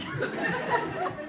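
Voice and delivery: breathy, soft, high little laugh; soft high giggle. Real, not acted. Old soldier giggling softly after the raincoat joke. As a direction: giggle quietly at your own gag.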